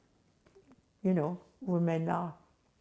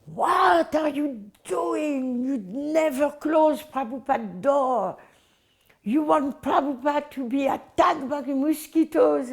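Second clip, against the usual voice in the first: retold scream; 4 semitones higher than usual. Man screaming at devotee over Prabhupada's door, retold scream. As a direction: imitate him screaming, higher but not at full volume. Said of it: "scream at me".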